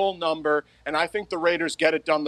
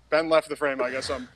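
normal talking voice

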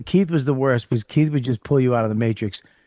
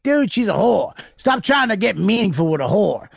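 raised-pitch mock scolding; imitating Keith; 6 semitones higher than usual. Friend scolds him for chatting with a woman, higher pitch. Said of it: "He's like".

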